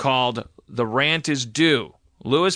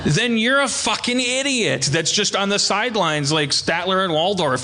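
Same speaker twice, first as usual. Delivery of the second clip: normal talking voice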